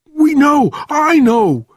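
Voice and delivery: very high, loud hysteria; shrieking with terror, voice pushed right up; panicked screaming. A narrator's reading. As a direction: scream it in wild disbelief. Sergeant still screaming in panic.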